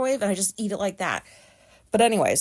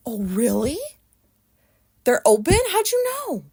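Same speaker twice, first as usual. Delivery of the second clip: mock-surprised, pitch jumping way up; sarcastic; 4 semitones higher, 3 dB louder than usual. Sarcastically asking the customer how she knew. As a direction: drip with fake amazement. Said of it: "she didn't get my sarcasm".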